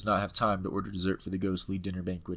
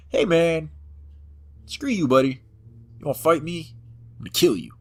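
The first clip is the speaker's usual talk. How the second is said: louder, hammed-up tough-guy challenge; mock fighting talk; 7 semitones higher, 8 dB louder than usual. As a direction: puff up and threaten the mortician. Squaring up to the monster.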